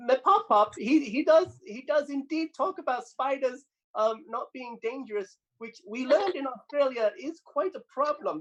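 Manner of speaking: high, bouncy little piglet voice; squeaky child cartoon pig, pleased with herself; Peppa Pig. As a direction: chirp it out like a cheeky cartoon piglet. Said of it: "I am Peppa Pig".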